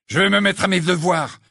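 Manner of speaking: furieux et plus fort; ton furieux. A narrator's reading. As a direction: coupe court, en colère. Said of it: "d'un ton furieux". (ton furieux de Ron vexé)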